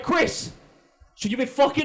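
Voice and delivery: high, loud Jersey dad demanding; New Jersey accent. Retold or imitated. Dad grilling son in New Jersey accent. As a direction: bark the question like a Jersey dad. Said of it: "I also gave him a New Jersey accent".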